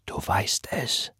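soft, slow whisper. Acted. Reverend to the woman, slow intimate whisper. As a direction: breathe it to her. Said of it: "flüsterte er".

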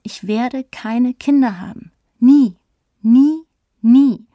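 slow declaration building to three loud, separate nevers; inner voice calling out. A narrator's reading. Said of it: "tief in mir drin ruft eine Stimme".

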